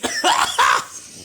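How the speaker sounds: loud hacking cough from a sore throat; harsh cough. Real, not acted. Host with a sore throat coughing mid-review, harsh cough. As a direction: cough hard and raspy. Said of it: "my croakies".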